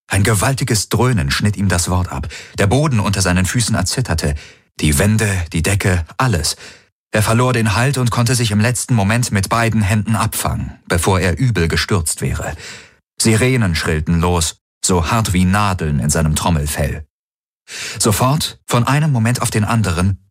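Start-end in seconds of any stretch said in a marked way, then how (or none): none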